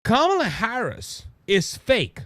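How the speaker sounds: ordinary talk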